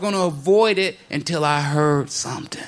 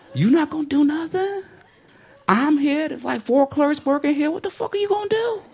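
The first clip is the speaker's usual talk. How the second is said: mocking taunt; 9 semitones higher than usual. Taunting the racist customer.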